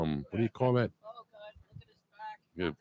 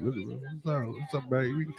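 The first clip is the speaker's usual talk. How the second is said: ordinary talk, nothing marked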